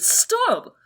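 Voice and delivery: sudden high shout; shouted command. Acted. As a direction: bark the order to halt. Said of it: "he's like".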